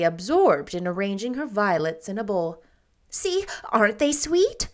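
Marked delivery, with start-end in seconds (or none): 3.1-4.7 put-on higher, quick girlish deflection; lightly hurried girl; hurried change of subject. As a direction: rush past the topic. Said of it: "she went on hurriedly".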